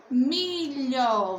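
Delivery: MILHO stretched out in two long syllables; reading aloud together. Shown as a demonstration. Teacher leading pupils in reading the word, stretched syllable by syllable. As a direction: read "milho" slowly and clearly, drawing out both syllables. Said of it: "lê comigo".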